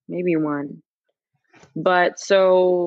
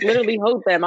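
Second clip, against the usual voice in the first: ordinary talk, nothing marked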